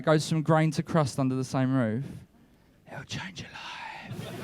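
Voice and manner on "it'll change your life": hushed, breathy secret; whisper. Acted. Comic confiding to a young audience member, whispered. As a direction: lean in and whisper it like a sacred truth. Confiding mock-profound revelation.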